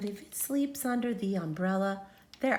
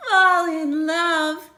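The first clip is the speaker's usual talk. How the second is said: swooning; 5 semitones higher, 8 dB louder, slower than usual. Acted. Romantic reading.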